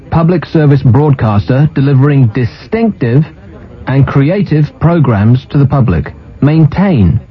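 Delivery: normal talking voice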